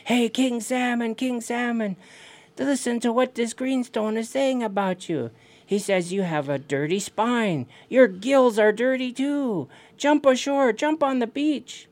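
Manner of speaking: high-pitched taunting call; yelling to the salmon. Acted. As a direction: call across the water, goading. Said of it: "So he yells at the king salmon again".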